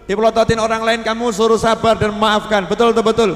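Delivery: loud, high fiery sermon; loud preacher counting out the steps; preaching at full force. Acted. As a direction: drive each point home to the crowd.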